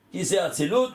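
ordinary talk, nothing marked

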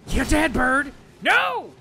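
high yelling at the bird; furious shout. Real, not acted. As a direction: scream at the enemy bird. Fighting a bird in game.

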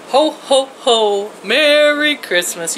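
a hint of Santa's belly laugh, drawn out; Santa Claus. Grandmother wishing viewers merry Christmas as Santa, lightly jolly. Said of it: "I used to play Santa Claus".

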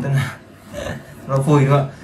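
ordinary talk, nothing marked

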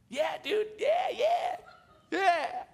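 high, loud, laughing yeahs; eager friends. Retold or imitated. Friends say yes to free lunch, high laughing shout.

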